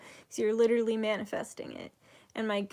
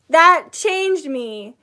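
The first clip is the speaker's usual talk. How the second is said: slow, loud, weighted That changed me; slow emphatic; 5 semitones higher, 11 dB louder, much slower than usual. Real, not acted. Telling viewers how the workshop changed her, slow and emphatic. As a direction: lean into That, slowly and firmly. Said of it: "emphatic slow".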